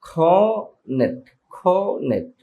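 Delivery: খনেট with aspirated k and no r, said slowly and high; correct cornet. Shown as a demonstration. Teacher drills খনেট slowly without the r sound. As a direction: drop the r: খনেট, breathy k. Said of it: "but এটা হবে".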